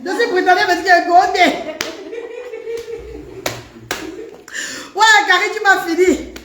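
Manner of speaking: high-pitched talk through laughter; laughing hard. Real, not acted. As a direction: squeal the words while cracking up. Cracking up while reading comment.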